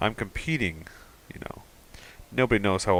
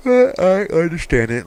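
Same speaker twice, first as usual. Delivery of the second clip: hammed-up character voice; 10 semitones higher, 10 dB louder than usual. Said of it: "I wish this was voice acted".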